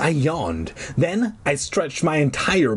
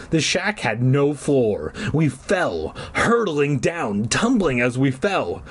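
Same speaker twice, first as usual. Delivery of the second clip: ordinary delivery, nothing marked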